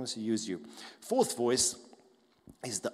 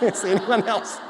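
laughing repeat; 8 semitones higher, 12 dB louder than usual. Retold or imitated. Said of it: "it's like".

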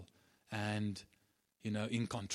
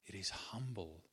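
usual speaking voice

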